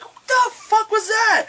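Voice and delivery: high slow disbelieving cry; shocked. Retold or imitated. Viewer reacting to the scream, high shocked disbelief. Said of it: "you're like".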